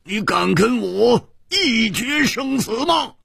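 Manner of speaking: high, drawn-out roar of challenge; angry shout. A narrator's reading. Hu Tiehua challenges the villain to a death fight, shouting angrily. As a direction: bellow the challenge slowly and furiously. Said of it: "怒喝道".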